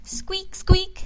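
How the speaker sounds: high squeaky mouse squeak. Acted. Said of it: "said Mouse".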